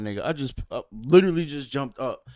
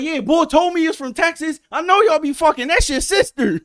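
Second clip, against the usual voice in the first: high, loud jeering; shouting insults over the fence; mock taunting shout; 12 semitones higher, 9 dB louder than usual. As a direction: taunt them at full volume. Neighbor taunting the family.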